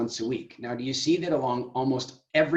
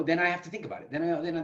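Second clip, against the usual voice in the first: normal talking voice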